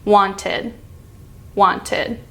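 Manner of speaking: "Wanted" ending in a light "id"; "Wanted" with the tongue tip on the roof of the mouth for "id". Shown as a demonstration. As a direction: end "Wanted" with an open "id". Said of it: "So we just did wanted".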